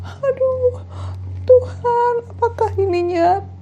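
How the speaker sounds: high, thin mock wail; woman in labour. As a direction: imitate a woman moaning through contractions. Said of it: "sebelah aku lagi kontraksi".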